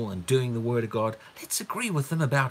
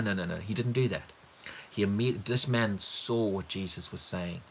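ordinary delivery

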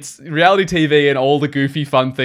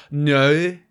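"No" with a Northern Irish vowel, lightly imitated; Northern Irish accent. Friend showing how the Irish queen says no, in a Northern Irish accent. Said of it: "Irish drag queen would say it like".

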